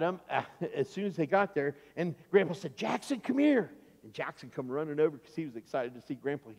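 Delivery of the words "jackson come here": higher-pitched friendly call. Acted. Grandpa calls his grandson over on arrival, calling out.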